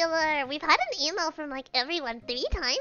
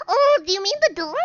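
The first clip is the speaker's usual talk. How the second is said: surprised; 5 semitones higher, 6 dB louder than usual. Acted. Reading letter, surprise.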